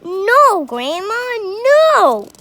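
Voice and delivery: high, loud alarmed call; boy calling back. A narrator's reading. George calling back to Grandma to stop. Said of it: "George called back".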